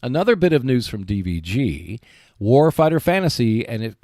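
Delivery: ordinary talk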